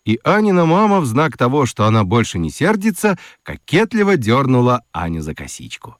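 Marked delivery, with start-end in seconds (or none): none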